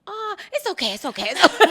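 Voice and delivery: high soothing father, breaking into laughter; comforting dad, laughing. Retold or imitated. Dad comforts his daughter, high and laughing. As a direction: soothe her, then laugh. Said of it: "he goes".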